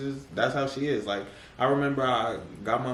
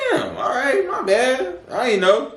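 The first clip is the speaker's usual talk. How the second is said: loud, much higher sheepish apology; high loud mock apology; 10 dB louder than usual. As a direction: yelp it out, embarrassed. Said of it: "I'm like".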